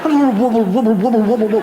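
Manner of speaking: laughing sing-song wobble, wobble; wobbly chant. Pretend. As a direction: chant wobble like jiggling bottoms. Mimicking wobbling bottoms.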